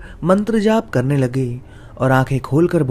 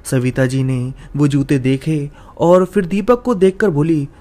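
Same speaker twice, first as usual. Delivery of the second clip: usual speaking voice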